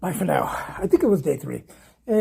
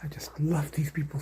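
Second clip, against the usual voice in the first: soft whisper; 2 semitones lower, 9 dB quieter than usual. Real, not acted. Whispering love for cats.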